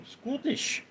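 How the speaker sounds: put-on high, soft Scottish-accented query; mock Scottish accent. Streamer querying a viewer's word, in a mock Scottish accent. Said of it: "Didn't think that was Scottish".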